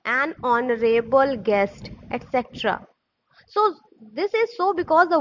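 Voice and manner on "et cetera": normal talking voice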